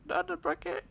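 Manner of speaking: very high-pitched grieving wail. Real, not acted. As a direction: cry it out in falsetto. Mourning the crushed bucket.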